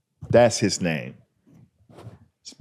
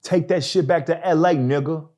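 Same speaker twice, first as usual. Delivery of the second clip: heckler taunt; 10 semitones higher than usual. Retold or imitated. Heckler mocking the comic's joke from the crowd. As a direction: sneer it at the comic. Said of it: "He goes".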